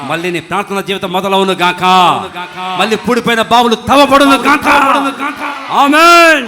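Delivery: shouted blessing climbing to a huge high Amen; full-force proclamation. Real, not acted. Preacher declares prayer life restored, shouting at full force.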